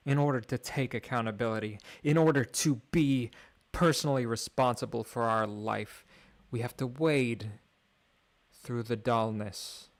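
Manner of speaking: rousing inspirational speech, rising and falling; grand speech. Acted. Streamer addressing his audience, mock-grand speech. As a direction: speak like a motivational orator.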